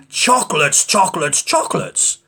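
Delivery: echoing chocolates; cave echo. Acted. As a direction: echo ringing back. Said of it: "the voice from the cave replied".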